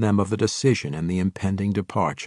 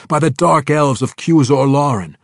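sharp retort, higher and louder, in another voice; 7 semitones higher, 8 dB louder than usual. A narrator's reading. Drow messenger to orc warlord, retorting. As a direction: snap back the excuse. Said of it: "the drow retorted".

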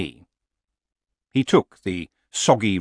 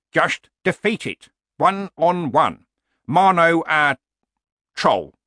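put-on old warrior voice, mostly pitched up; stubborn old barbarian; 8 semitones higher, 4 dB louder than usual. Old barbarian justifies fighting a troll, stubbornly. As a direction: insist on it, old fighter to doubter.

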